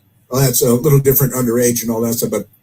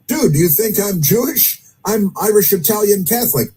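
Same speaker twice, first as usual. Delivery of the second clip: higher-pitched, incredulous comeback; retold indignation; 7 semitones higher than usual. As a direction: act out a mildly exasperated retort.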